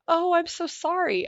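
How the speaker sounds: high, slow pitying "so sorry"; mock pity. Mimicking people's pity over her surgery, high and slow. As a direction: drag out a syrupy mock condolence. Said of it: "I had everybody go".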